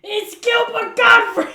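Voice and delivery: high, slow comic yell; loud Gilbert Gottfried. Acted. Friend trying the comedian impression, loud. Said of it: "My impression is not that good either".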